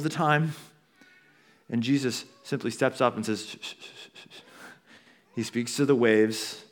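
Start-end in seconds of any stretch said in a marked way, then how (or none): 3.4-4.4 very soft, repeated shushes; gentle shushing. Acted. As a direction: hush the storm softly. Acts Jesus hushing the storm.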